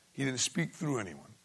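ordinary talk

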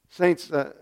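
usual speaking voice

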